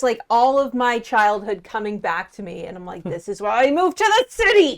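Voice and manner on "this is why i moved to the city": frustrated outburst. Acted. Character's frustrated inner outburst.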